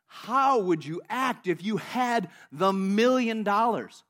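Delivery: very slow, emphatic voice of God; God speaking. Retold or imitated. God presses the question on this guy, very slow and emphatic. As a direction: stretch each word out with firm weight. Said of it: "God says to this guy".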